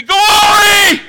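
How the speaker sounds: high, loud shout of Glory; shouted praise. Shown as a demonstration. Preacher showing what freed people say, loud shout. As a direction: cry it out to heaven.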